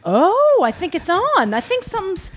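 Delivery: high, uneasy dawning realization; hammed-up nervous anticipation; mock dread. Acting dread before vomiting.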